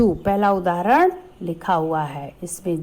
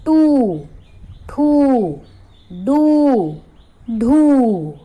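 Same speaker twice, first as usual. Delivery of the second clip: higher and louder, each syllable called out; loud drilled syllables; 5 semitones higher, 6 dB louder, much slower than usual. Shown as a demonstration. Teacher calling syllables to children, loud. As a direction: ring out चू छू जू झू. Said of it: "ट पर बड़े ऊ की मात्रा".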